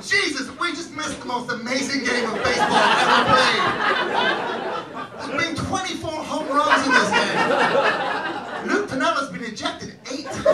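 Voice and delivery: high, loud exclaiming; amazed excitement. Acted. Drunk fan watching Cubs highlights, amazed mock excitement.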